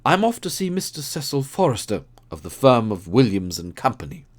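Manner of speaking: lightly voiced keen detective; Holmes voice. Holmes voiced in read story.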